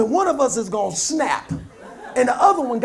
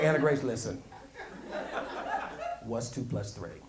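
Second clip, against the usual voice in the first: mock patient dad; 11 semitones lower, 15 dB quieter than usual. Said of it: "I'm like".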